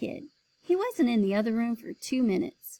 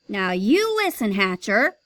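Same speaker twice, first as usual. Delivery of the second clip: loud angry demand; raised voice; 7 dB louder than usual. Acted. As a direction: lay down the law. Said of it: "Mister Vincent raised his voice".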